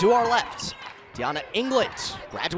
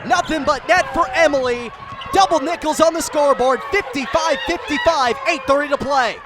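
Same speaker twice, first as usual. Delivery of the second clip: higher and louder, a big call on the tying shot; excited shout; 5 semitones higher, 5 dB louder than usual. Real, not acted. Announcer calling the game-tying three, loud excited shout. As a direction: let it rip as the net snaps.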